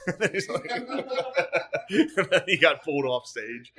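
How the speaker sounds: slow, high laughing words. Real, not acted. Recounting the comedian's fainting to a friend, laughing. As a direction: drag it out through laughter. Laughing while telling story.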